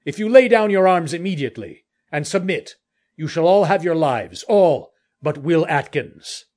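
high hail easing into firm, loud orders; calling out. A narrator's reading. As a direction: call the terms out with authority. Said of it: "the captain himself then called out".

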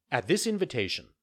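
usual speaking voice

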